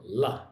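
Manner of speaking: La with the tongue tip on the top of the mouth; tongue tip up behind the teeth, La; L sound demo. Shown as a demonstration. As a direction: clear L, tongue on the roof of the mouth. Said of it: "How to pronounce the L sound".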